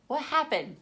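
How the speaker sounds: ordinary delivery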